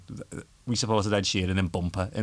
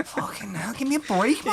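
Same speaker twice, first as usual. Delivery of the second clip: exasperated mock groan; 13 semitones higher than usual. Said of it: "you're like".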